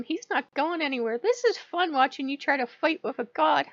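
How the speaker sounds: high, amused taunting god voice; voicing a gleeful god; playful god taunting. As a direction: tease like a smug deity. Voicing Odin taunting her.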